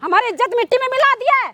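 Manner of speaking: outraged mother's tirade; scornful, indignant scolding; angry scolding. Acted. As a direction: berate him with indignant questions. Acted mother berates daughter.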